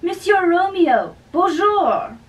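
a lightly put-on French accent, teasing; mock French greeting. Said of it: "that's a French hello".

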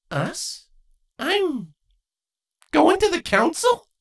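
put-on young mare lifted high and light; Twilight's voice. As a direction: voice Twilight, gently doubtful.

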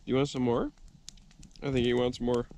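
normal talking voice

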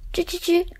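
high little squeaking chatter; mouse squeak. Acted. Mice chattering. (小老鼠吱吱叫)

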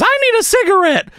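high, quick puppet voice; Elmo. Acted. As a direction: rattle it off as squeaky Elmo. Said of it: "Elmo says".

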